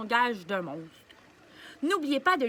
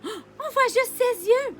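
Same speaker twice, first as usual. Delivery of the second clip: higher, louder exclaimed oh; surprised; 9 semitones higher, 4 dB louder than usual. Acted. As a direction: gasp in amazement. Invisible monster.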